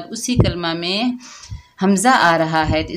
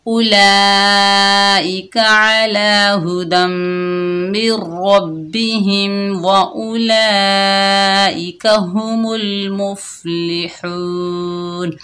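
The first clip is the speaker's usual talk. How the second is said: slow, drawn-out melodic tajweed chant; Quranic recitation; much slower than usual. Acted. Teacher reciting verses to students in slow tajweed chant. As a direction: stretch each vowel in measured recitation.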